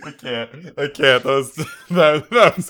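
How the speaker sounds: high and loud through laughter; barely getting words out laughing; laughing hard. Real, not acted. Cracking up at the joke.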